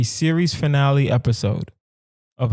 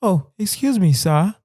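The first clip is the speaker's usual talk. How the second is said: a hammed-up, overly polite British accent, much higher; a failed posh British "excuse me, sir", pitched way up; bad British accent; 8 semitones higher than usual. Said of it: "I don't know how to do a British accent".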